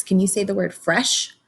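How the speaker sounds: ordinary delivery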